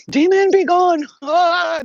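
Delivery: high, loud shout banishing a demon; hammed-up high, loud exorcist cry; mock exorcism. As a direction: cast out the demon theatrically. Pretend exorcism with sage.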